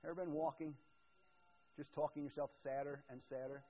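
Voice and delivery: usual speaking voice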